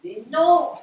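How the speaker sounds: loud exasperated cry. Acted. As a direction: shout him down in disbelief. Exasperated with the dim caller.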